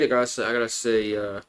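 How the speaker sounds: usual speaking voice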